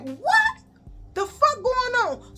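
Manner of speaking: high-pitched acted panic; panicking. Student warned to flee the school, high panicked squeal. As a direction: squeak it out in mock alarm.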